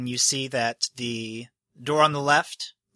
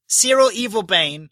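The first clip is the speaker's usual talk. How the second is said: higher and louder, announcing each name; formal naming; 7 semitones higher, 6 dB louder than usual. Acted. Host calling out a guardian by name, loud. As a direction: call the name out like a herald. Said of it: "he points to Cyril".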